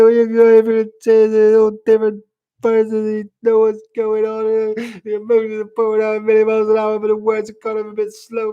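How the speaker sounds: high, loud wailing mock cry; crying impression. Streamer mocking people who talk whilst crying, fake crying wail. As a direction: wail the words out in one long hammed-up sob. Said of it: "That's my impression of crying there".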